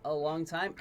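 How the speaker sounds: ordinary delivery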